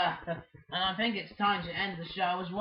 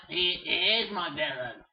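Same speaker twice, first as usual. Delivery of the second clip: usual speaking voice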